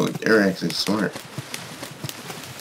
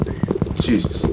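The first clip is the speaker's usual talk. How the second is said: ordinary talk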